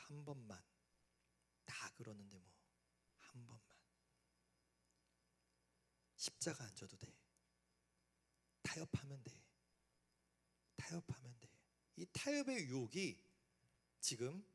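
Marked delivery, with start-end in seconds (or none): 0.1-11.5 hushed, coaxing devil voice; a tempter coaxing a student to give in; tempter's whisper. Acted. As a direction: whisper the bait, soft and sly. Tempter voice again.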